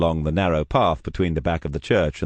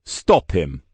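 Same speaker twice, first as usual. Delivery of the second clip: louder called command; calling out; 6 semitones higher, 4 dB louder than usual. Acted. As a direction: call the order across the church. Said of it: "he called".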